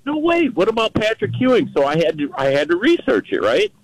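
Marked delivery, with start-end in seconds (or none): 0.0-1.7 high-pitched incredulous outburst; recalling your own astonished reaction; retold disbelief. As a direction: pitch jumps up in mock protest. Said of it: "I'm like".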